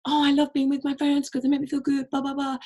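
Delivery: high gushy mock voice; mocking gushy post. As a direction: parody a sappy influencer. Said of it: "a post about".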